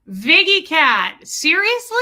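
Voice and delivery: slow, stretched name then a high, loud, amazed seriously; delighted surprise. Real, not acted. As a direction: greet a long-lost friend with disbelief. Chatter returns after long absence.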